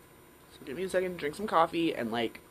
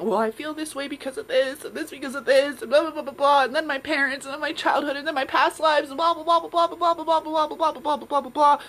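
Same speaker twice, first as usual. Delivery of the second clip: mock journaling ramble; 9 semitones higher, 4 dB louder than usual. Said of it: "hours journaling it".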